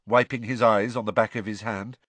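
usual speaking voice

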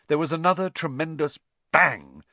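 usual speaking voice

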